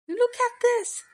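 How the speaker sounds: high, bright discovery; high excited squeak. Acted. Lottie excitedly shows the note.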